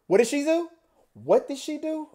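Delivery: high-pitched, eager questioning; mock gossipy shock. Outsider joining housewives' gossip, shocked questioning.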